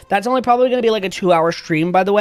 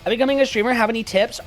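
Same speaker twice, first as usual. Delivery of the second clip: ordinary talk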